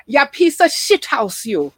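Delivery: hammed-up Jamaican accent scolding like mother; bad Jamaican accent. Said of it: "the worst Jamaican accent ever".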